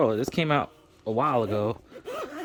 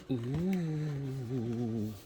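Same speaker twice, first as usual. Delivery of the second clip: long, soft drawn-out "ooh"; admiring ooh; 6 dB quieter than usual. Real, not acted. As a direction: let out a quiet, lingering ooh of admiration. Admiring the newly opened controller.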